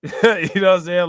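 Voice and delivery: a real laugh spilling into the words. Real, not acted. Brushing off a viewer's Cincinnati question with a laugh.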